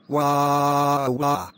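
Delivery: hammed-up sing-song wah wah wail; mock crying. Grounded [name] wails after being sent to bed, mock crying. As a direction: bawl like a grounded kid, playfully overdone.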